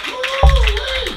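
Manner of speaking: high, very loud woo-wee; loud whoop. Real, not acted. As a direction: whoop to fire up the crowd. Crowd hype whoop.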